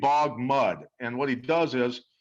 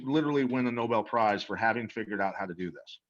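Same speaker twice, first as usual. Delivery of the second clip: normal talking voice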